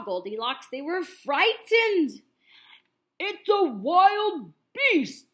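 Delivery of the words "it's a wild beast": slow, loud bear growl; growling Papa Bear. A narrator's reading. Papa Bear seeing Goldilocks in bed, slow loud growl. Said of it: "Papa Bear growled".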